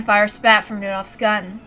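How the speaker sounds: plain reading voice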